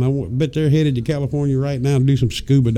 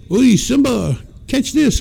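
much higher, gleeful "catch this"; playful excited shout; 9 semitones higher than usual. Pretend. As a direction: toss it playfully, pitch up high. Playing snowball fight with elephant.

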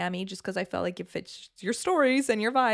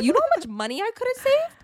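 high, loud disbelief; incredulous; 5 dB louder than usual. Retold or imitated. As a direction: squeal it in disbelief. Incredulous punchline quote.

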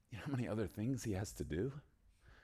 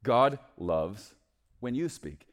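ordinary talk

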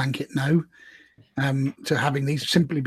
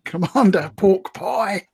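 put-on Cockney accent; 5 semitones higher, 5 dB louder than usual. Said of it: "shall I do this in a Cockney accent?".

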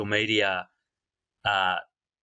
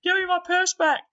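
high falsetto woman's voice; shrill squeaky female impression; 5 dB louder than usual. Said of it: "Some shit like that".

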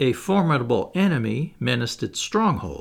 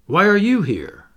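higher, louder startled question; surprised cry; 6 semitones higher, 6 dB louder than usual. A narrator's reading. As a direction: demand it, raised in pitch and volume. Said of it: "cried the captain".

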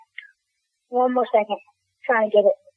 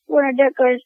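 normal talking voice

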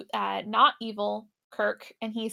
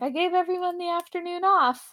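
lightly jovial, higher-pitched quote; 7 semitones higher than usual. Retold or imitated. Podcaster quoting the captain making light of trouble, jovial. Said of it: "trying to be, like, jovial about it".

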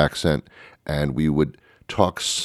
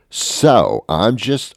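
ordinary talk

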